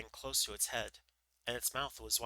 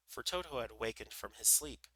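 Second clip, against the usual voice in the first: plain reading voice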